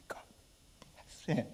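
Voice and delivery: very soft, voice nearly gone; choked up. Real, not acted. Preacher blessing the brave father in the story, choked up. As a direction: barely get the blessing out through emotion.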